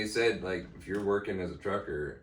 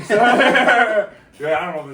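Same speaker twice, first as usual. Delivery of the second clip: laughing; 11 semitones higher, 12 dB louder, slower than usual. Real, not acted. Laughs mid-line.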